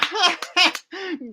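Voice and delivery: high cackling laugh at the joke; mocking laugh.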